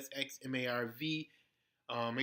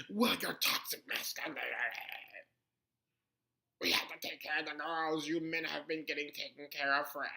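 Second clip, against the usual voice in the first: lightly put-on lecturing voice; mock preachy voice. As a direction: play the self-righteous critic. Voicing the opposing side.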